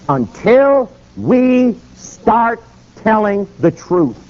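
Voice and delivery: very slow, high, separated words; solemn pronouncement. Retold or imitated. Preacher answering his worried friend, emphatic and slow. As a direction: spell it out word by word with conviction.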